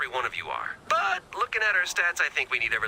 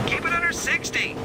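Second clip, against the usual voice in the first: high, raised-voice warning; called-out warning; 13 semitones higher, 4 dB louder than usual. Acted. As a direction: call it after a departing driver. Calling warnings after the departing driver.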